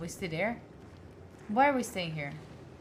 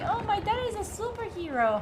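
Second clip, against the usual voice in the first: put-on much higher, wide-eyed child voice; awed kid; 10 semitones higher than usual. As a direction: imitate a kid in awe of dad. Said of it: "the kid will be thinking".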